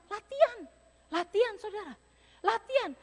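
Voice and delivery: high ringing call of latihan; emphatic cry. Real, not acted. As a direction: hammer the word latihan home. Preacher calling out key word. (seruan latihan yang bersemangat)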